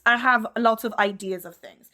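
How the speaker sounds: ordinary talk, nothing marked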